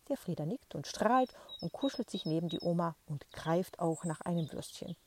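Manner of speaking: ordinary delivery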